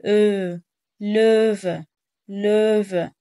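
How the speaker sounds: لوفا with a long ö, mouth shaped like a circle; round open ö. Shown as a demonstration. Teacher models for the learner, loud open ö. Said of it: "بتخليها شبه الخمسة".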